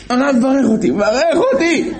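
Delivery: high, begging cry for a blessing; pleading plea. Retold or imitated. Informer falls at rabbi's feet, begging high and loud.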